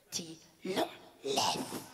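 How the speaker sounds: mock villain voice; deep threat. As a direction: drop the voice low and menacing. The lady's threat deepens.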